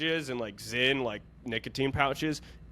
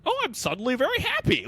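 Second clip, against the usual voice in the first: high, chirpy fake joy; hammed-up bright relief; mock sudden happiness; 14 semitones higher than usual. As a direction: pretend the nicotine just fixed everything. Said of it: "and you're like".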